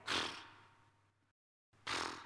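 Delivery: dismissive puffs of air; scoffing pfft. Retold or imitated. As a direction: scoff with two pfft sounds. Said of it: "I would say".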